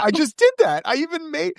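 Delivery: high-pitched, mock-outraged stammer; acted indignant protest. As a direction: protest in a squeaky put-on voice. Acts robbed painter protesting theft.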